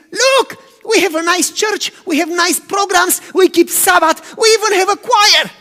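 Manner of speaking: proud boasting. Retold or imitated. Disciples boasting to Jesus about their church, loud and high. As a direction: show off the church with pride.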